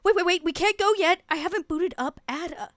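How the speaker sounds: high, loud, hurried character voice; quaint voice. Acted. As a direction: blurt it out high and urgent, a quaint young woman. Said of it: "her kind of quaint voice goes".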